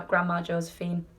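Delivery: ordinary delivery, nothing marked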